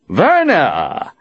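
loud, cheerful call. Acted. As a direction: call her across the clearing. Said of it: "Nathan called out".

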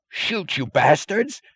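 slow, high desperate order; scream. A narrator's reading. Chief screaming at his wavering men. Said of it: "screamed the chief".

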